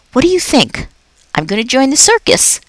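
a jolly night janitor chatting to a pet; upbeat, chatty custodian; Aldo the cheerful janitor. Acted. Aldo after his trick.

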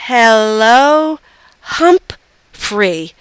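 drawn-out, halting syllables; learner's careful attempt. A narrator's reading. Mother copies her daughter's English, slow and halting. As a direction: sound it out slowly, word by word. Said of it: "Missus Nasiri said".

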